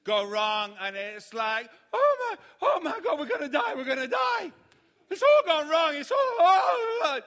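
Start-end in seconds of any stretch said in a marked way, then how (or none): 1.9-7.2 high, loud, frantic wail; panicked shouting. Retold or imitated. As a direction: shout it like everything's collapsing. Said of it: "they have this sense of panic".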